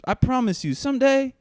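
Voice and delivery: high, loud slick dealer voice; a sleazy pitch, way up high; voiced dealer Fulgencio. Dealer Fulgencio pushing weed on a kid, high sleazy voice. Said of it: "he'd go".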